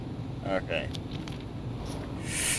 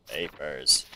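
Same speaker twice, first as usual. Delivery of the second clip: much lower, put-on deep voice for papers; 8 semitones lower, 8 dB quieter than usual. Gamer playing the inspector, deep mock voice. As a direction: sink the voice low and heavy. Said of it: "I'll just do a deep voice".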